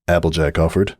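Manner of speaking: plain reading voice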